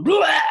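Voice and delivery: loud high gagging noise; father acting out throwing up on his kids, loud retch; mock retching. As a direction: belt out a fake vomit sound.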